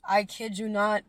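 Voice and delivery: ordinary talk, nothing marked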